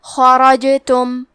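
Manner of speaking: خرجتم slowly with the jeem bounced in a small echo; qalqala on jeem. Shown as a demonstration. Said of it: "حرف الجیم کو ہلاتے ہوئے".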